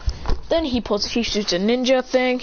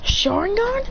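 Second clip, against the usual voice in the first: "Sharingan" high with a rising end; puzzled question; 11 semitones higher, slower than usual. Retold or imitated. He answers Naruto, "Sharingan" as a high rising question. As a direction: lift "Sharingan" into a surprised question.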